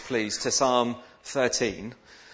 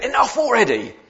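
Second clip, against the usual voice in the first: high, loud mock fed-up outburst; exasperated catchphrase; 10 semitones higher, 6 dB louder than usual. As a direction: cry out in playful exasperation. Repeats exasperated catchphrase about emails.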